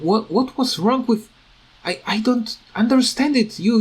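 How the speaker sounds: ordinary delivery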